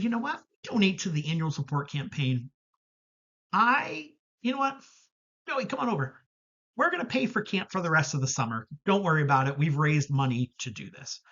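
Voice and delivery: high, bright-eyed rush of a promise; over-eager young counselor blurting it out. Retold or imitated. Counselor eagerly promises the boy a paid summer, in a high, excited voice.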